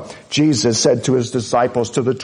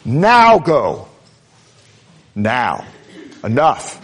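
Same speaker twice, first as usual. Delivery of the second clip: Pharaoh's impatient "Now go", stressed; emphatic command; 2 semitones lower, slower than usual. Acted. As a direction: bark Pharaoh's order with weight on "Now". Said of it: "it's emphatic".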